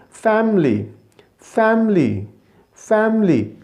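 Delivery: "फैमिली" as fam-lee, high then falling; correct pronunciation. Shown as a demonstration. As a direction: repeat "फैमिली" without the middle vowel, pausing between. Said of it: "तो इसे क्या कहेंगे जी?".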